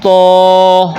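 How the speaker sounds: تو held long with a heavy, rounded vowel; drawn-out emphatic تو; thick Arabic t. Shown as a demonstration. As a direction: recite تو full-mouthed on a steady note. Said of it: "موٹی آواز کے ساتھ پڑھیں گے".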